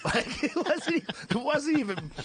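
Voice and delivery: laughing. Real, not acted. Laughing at own story.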